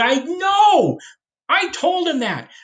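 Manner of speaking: a hint of an exasperated president; Washington's voice. History teacher voicing Washington, high-pitched and exasperated.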